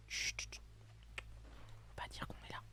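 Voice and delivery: hushed whisper, trying not to be heard; whispering while hiding. Real, not acted. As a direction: whisper so nobody hears. Sneaking past enemies in game.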